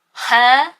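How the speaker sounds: loud, baffled huh; a stunned, disbelieving huh; incredulous huh. Real, not acted. Reviewer reacting to the book's breakup, loud disbelief.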